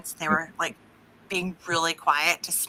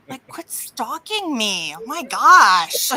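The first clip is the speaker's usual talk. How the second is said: mock exasperation; 5 semitones higher, 7 dB louder, slower than usual.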